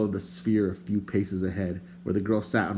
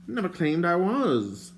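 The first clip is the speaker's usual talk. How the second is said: shouting back; 8 semitones higher, slower than usual. A narrator's reading. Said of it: "the stranger shouted back".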